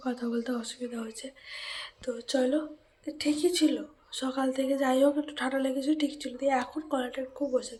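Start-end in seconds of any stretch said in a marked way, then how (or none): none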